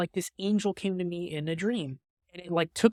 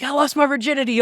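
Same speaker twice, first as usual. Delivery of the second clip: mock friends bragging; 9 semitones higher, 8 dB louder than usual. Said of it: "They're like".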